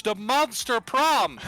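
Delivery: slow, high, booming title read; dramatic announcer. Acted. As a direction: announce it like a trailer. Dramatic reading of game title.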